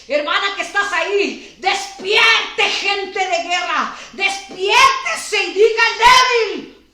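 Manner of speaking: fervent shout. Real, not acted. Pastor urging a sister to wake, fervent shout. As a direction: shout her awake.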